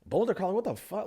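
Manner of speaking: high-pitched incredulous echo; baffled disbelief. Retold or imitated. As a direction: throw it back in high, mock bewilderment. Retells his baffled reply on stage.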